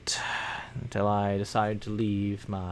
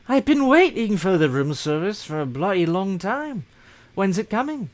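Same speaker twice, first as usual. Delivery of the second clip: grumpy hotel guest; 7 semitones higher, 4 dB louder than usual. Pretend.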